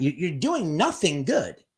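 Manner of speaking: ordinary talk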